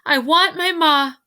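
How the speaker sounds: high, loud cry for her ma. A narrator's reading. Mig cries for her ma into the silent world, crying out. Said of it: "cried Mig".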